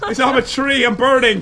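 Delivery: high mock-distressed cry; comic panic. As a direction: wail playfully about being hurt. Player burning while morphed as tree.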